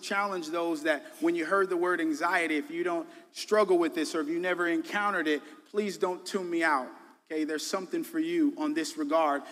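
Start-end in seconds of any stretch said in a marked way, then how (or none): none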